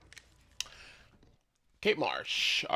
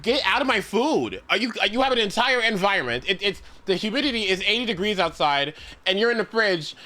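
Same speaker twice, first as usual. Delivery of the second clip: exasperated rant; 6 semitones higher than usual. Real, not acted. Ranting at the mold.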